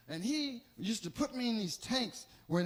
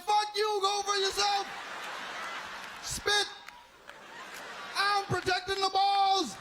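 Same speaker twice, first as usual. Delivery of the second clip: defiant shouting; 9 semitones higher than usual. Pretend. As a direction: bark it back defiantly. Dick refuses to help chest.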